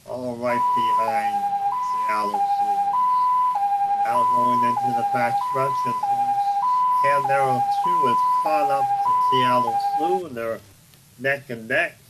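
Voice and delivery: loud race announcer; excited race call. Acted. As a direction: call the horses loudly down the stretch.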